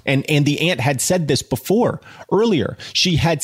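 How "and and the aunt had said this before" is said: ordinary delivery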